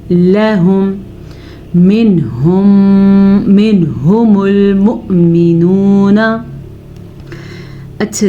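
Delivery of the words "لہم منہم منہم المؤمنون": slow melodic tajweed chanting; Quran recitation. Acted. Teacher reciting the verse slowly for students, melodic chant. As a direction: draw each verse out in measured recitation.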